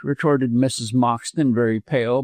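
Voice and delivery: plain reading voice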